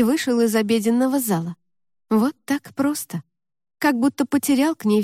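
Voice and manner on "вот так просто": ordinary delivery, nothing marked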